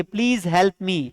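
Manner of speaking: very slow, word by word, for the class; slow model sentence. Shown as a demonstration. Teacher dictating an English sentence to students, very slowly. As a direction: spell each English word out slowly for learners. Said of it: "कृपया मेरी मदद कीजिए".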